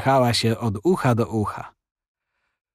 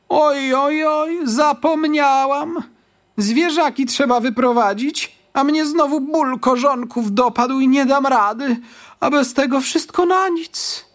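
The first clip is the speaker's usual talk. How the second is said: put-on pleading old lady. A narrator's reading. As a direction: groan and beg as the aching old lady. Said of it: "Spojrzała błagalnie na Marylkę".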